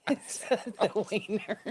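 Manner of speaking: giggling through the name; slow words broken by laughter; laughing. Real, not acted.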